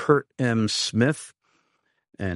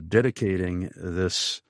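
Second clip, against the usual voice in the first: normal talking voice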